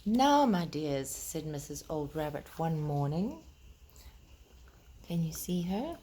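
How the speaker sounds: put-on gentle, lilting read-aloud to children; storybook reading. Reading Peter Rabbit aloud to the children, gentle storytelling voice.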